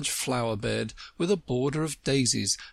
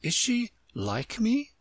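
high, hopeful question; eager; 12 semitones higher, slower than usual. A narrator's reading. Alice hoping for another little girl, eager. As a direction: ask it eagerly, high and drawn out. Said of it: "Alice asked eagerly".

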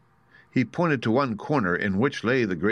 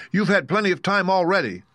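much higher-pitched, indignant protest; angry; 11 semitones higher, 4 dB louder than usual. Acted. Tin Woodman objecting to the wizard's delay, angrily. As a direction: protest sharply at being put off. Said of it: "said the Tin Woodman angrily".

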